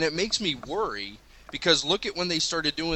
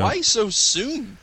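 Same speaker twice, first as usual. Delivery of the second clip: drawn out and slow, exasperated; a louder, higher, exasperated why; 8 semitones higher, 5 dB louder, much slower than usual. Retold or imitated. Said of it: "I was like".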